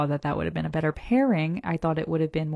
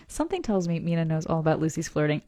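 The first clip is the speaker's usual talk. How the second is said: usual speaking voice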